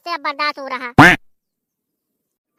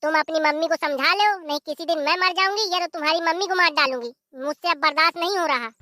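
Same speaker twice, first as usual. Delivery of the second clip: ordinary delivery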